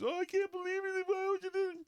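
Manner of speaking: mock her wounded disbelief; mock whiny girlfriend. Girlfriend hearing she's dumped, high mock whine. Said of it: "And then she's".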